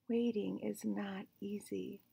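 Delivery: soft, slow, weary whisper; sighing whisper. Acted. Gerald sighs that waiting is hard, soft whisper. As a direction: breathe it out in defeat.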